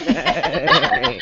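neigh through a laugh. Shown as a demonstration. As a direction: giggle out a horse neigh. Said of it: "we're starting to sound like a goat".

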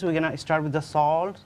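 ordinary delivery

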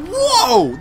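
loud high yelp of surprise; jolted shout as the boss lunges; sudden shocked cry; startled whoa. Real, not acted.

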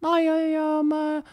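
high, soft mock Beckham voice; mock a soft, high-voiced David Beckham; imitating David Beckham. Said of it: "he's like".

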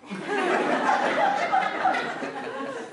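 breaks into high giggles; can't hold back the laughter; real giggling fit; high laughing. Real, not acted. Narrator cracks up.

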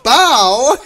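shouted high bow for the hit; loud punch sound effect. Pretend. Gamer watching a fight, shouting punch noise.

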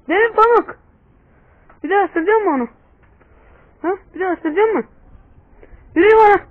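high, loud scolding of a naughty dog. Real, not acted. Vlogger scolds his dog Pamuk for biting the puppy, high loud scolding.

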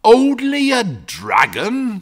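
high, loud, drawn-out disbelief; indignant cry. A narrator's reading. Father echoing his son's words, outraged cry. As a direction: cry it out slowly, high and incredulous. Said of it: "cried his father".

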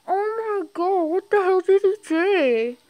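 very loud, high exclamation; real shock, high and stretched out; shocked outburst. Real, not acted. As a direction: burst out in disbelief at the villain. Reader's outburst at the story.

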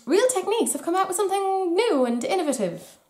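high-pitched mock amazement; mock excitement. Beauty vlogger joking about a brand's launch, mock excitement. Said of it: "Not really, I'm just joking".

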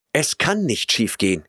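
ordinary delivery

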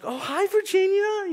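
high, slow, sugary hello to the teacher; sugary mom greeting. Retold or imitated. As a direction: greet her all sweetness, drawn way up high.

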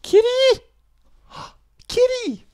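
squeaky high excited call; high-pitched kitty call. Real, not acted. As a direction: call the cat in a tiny high voice. Calling the cat excitedly.

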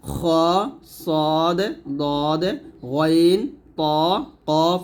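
each of "খ, সদ, দদ, গইন, ত, কফ" full and rounded; thick heavy letters. Shown as a demonstration. Teacher listing the thick letters, heavy and rounded. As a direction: say "খ, সদ, দদ, গইন, ত, কফ" heavily, held long. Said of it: "মোটা হরফগুলো হলো".

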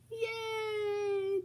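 high, bright little cheer. Real, not acted. Reader cheers with the child at the happy ending.

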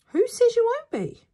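high, loud, challenging question; dad's reply. Acted. Dad answering in story.

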